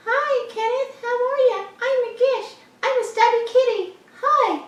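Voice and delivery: very high squeaky cat voice; high-pitched pet voice. Pretend. Voicing the cat.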